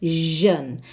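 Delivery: "jeune" set apart with a buzzing zh; sound of jeune. Shown as a demonstration. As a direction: say "jeune" as a model ending, zh as in vision. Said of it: "Pay particular attention to".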